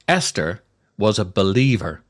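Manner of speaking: ordinary talk, nothing marked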